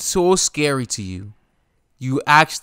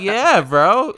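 laughing; 10 semitones higher, 5 dB louder than usual. Real, not acted. Laughing along with his friend.

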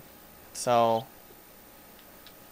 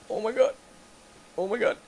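high, panicky little cries; panicked squeak; 10 semitones higher, faster than usual. Real, not acted. Gamer cornered by creatures, high panicked squeak. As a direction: squeal it in rising dread.

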